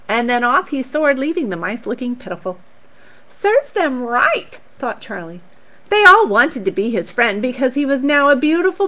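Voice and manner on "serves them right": smug. Acted. Charlie thinks smugly.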